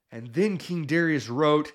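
usual speaking voice

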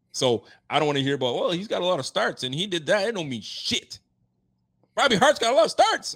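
heated, rising rant, loud at the end; sounding angry while not really angry; worked-up rant. Real, not acted. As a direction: vent at the critics, voice climbing high. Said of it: "got me sounding freaking angry".

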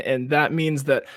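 usual speaking voice

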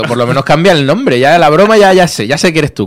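fed up with the joke; exasperated. Retold or imitated. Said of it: "Cabrón".

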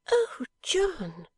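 high falling wail of despair; exasperated wife. A narrator's reading. Wife gives up on her husband, exasperated. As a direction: give him up as a bad job. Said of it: "retorted Bella, as if she were giving him up".

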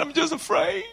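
small scared voice. Retold or imitated. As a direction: let the fear shrink the voice. Bride voiced repeating her fear.

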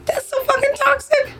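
emphatic outburst. Real, not acted. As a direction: voice jumps way up, exasperated. Outburst after forgiveness example.

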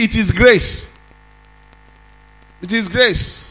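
emphatic preacher, stretching key words; forceful preaching. Real, not acted.

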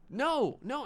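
high, loud disbelieving no; the coworker can't believe what he hears, shocked and high; shocked denial. Retold or imitated.

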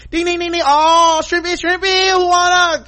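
very high, loud hammed-up chef hawking shrimp; sing-song the chef tossing shrimp to the table; mock showman chef. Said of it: "they be like".

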